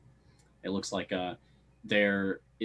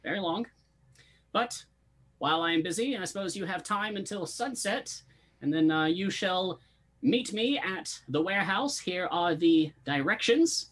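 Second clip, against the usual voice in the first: Master Stumbleduck; 9 semitones higher than usual. Acted. Boss handing the party directions, high-pitched character voice. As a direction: pitch it well up, stretching key words. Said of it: "turns to you and says".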